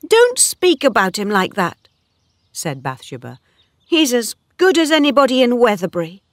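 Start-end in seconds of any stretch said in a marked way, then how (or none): 0.1-1.9 higher, louder indignant objection. A narrator's reading. Bathsheba defends Troy to Oak, indignant. 3.8-6.2 high, fast, loud defence; indignant. A narrator's reading. Bathsheba defends Troy to Oak, indignant and hurried.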